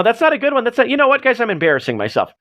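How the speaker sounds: ordinary delivery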